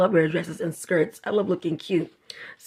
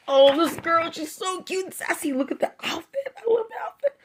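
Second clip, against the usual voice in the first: cooing; 9 semitones higher than usual. Real, not acted. Cooing over a drawing.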